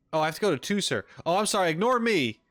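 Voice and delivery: ordinary talk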